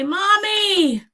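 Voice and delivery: high-pitched imitation of an excited toddler; imitated toddler squeal of recognition. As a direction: call out like a delighted little child. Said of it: "he'd get excited".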